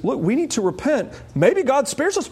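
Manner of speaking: lightly acted people of Nineveh shrugging at their fate; Ninevites' plea. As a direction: voice the townsfolk hoping God spares them. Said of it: "Their repentance is".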